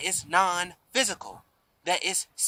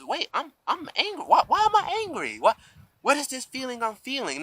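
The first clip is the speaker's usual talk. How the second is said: much higher, bewildered inner voice; startled realization; 9 semitones higher than usual. Retold or imitated. Person suddenly noticing their own anger, high bewildered voice. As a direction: suddenly catch yourself being angry, high and puzzled. Imitating someone realizing they're angry.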